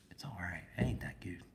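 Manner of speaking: hushed lukewarm verdict; whisper. Real, not acted. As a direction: whisper it, unimpressed.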